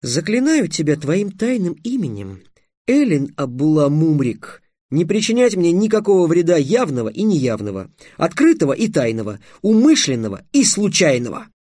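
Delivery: ringing binding spell; solemn incantation. A narrator's reading. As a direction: proclaim it ceremonially, item by item. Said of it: "торжественно сказал Трикс".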